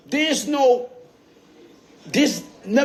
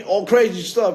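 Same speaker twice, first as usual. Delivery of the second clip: ordinary talk, nothing marked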